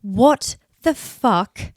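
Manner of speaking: lost and exasperated, dragging each word; despairing inner thought. Retold or imitated. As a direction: spell out the despair slowly to yourself. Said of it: "I just think".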